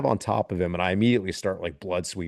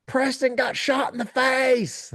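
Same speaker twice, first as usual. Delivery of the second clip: high, drawn-out Southern holler; comically hammed-up scream, slow and high; imitated Louisiana scream; 4 dB louder, slower than usual. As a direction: mimic a thick-accented man screaming bad news. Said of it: "just the way he's screaming it".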